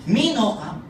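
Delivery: high teasing pidgin question; lightly mocking skeptics; imitating doubters. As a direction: tease him like old friends. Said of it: "they will tell me".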